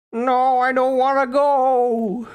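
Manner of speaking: high, loud mock wail; hammed-up panicked wail. Victim being sent through a portal, hammed-up panicked wail.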